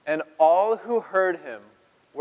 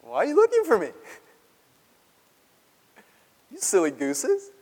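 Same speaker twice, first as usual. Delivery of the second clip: put-on cheeky boy; 6 semitones higher than usual. Boy Jesus answering his parents, high and cheeky. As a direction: play a sassy twelve-year-old. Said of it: "look at this response".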